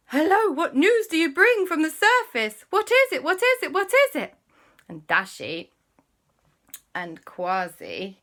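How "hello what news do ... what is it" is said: high, loud, urgent pleading; eager royals. Acted. King and queen begging for news, high and loud. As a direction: demand news breathlessly. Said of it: "the king and the queen went".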